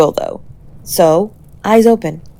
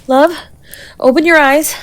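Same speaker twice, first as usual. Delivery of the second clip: high, loud plea to wake up; desperate plea; 8 semitones higher, 5 dB louder than usual. Acted. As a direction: beg them to open their eyes. Begging the unconscious partner.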